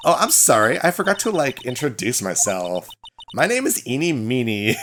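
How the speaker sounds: lightly voiced ditzy Valley Girl; Valley Girl accent. Student greeting the lawyer in a Valley Girl accent. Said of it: "another Valley Girl accent".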